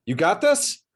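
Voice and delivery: lightly imitating the wary client; doubtful biker. Biker client doubting his tattoo artist, mock-suspicious. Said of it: "He says".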